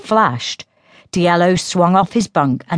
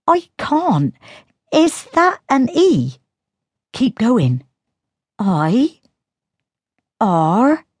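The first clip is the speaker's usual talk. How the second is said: put-on higher, light teasing girl's voice; girl Synth voice; 7 semitones higher, slower than usual. A narrator's reading. Sister taunting Diello about his tale, in a higher teasing voice. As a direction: taunt him as the younger sister.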